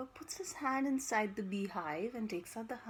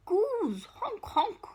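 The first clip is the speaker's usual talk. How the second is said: much higher, loud honk honk; honking goose; 10 semitones higher, 7 dB louder, much slower than usual. Acted. Storyteller honking the goose for the children.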